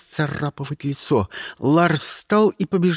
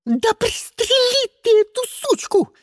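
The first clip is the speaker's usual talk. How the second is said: very high-pitched squeal; shrieking; 3 dB louder than usual. A narrator's reading. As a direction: screech the order furiously. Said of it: "завизжала". (пронзительный визг)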